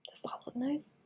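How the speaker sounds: a hushed whisper. Real, not acted. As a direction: whisper it to yourself. Unvoiced aside while painting.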